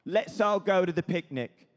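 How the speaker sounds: British rock-star drawl in short bursts; exaggerated rock-star musing, slowing at the end. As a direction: think aloud like a swaggering rock star. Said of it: "and he goes".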